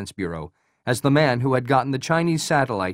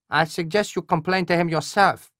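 nasal Korean colonel; 6 semitones higher than usual. Acted. Voices Colonel Hwan on phone.

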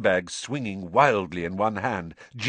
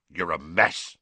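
gruff; 4 semitones lower than usual. A narrator's reading. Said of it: "said Hagrid gruffly".

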